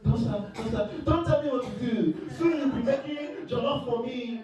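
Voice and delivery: put-on high, playful African-accented countdown. Playful African-accented talk to partner in bed. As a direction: play it up in the accent. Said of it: "in the accent".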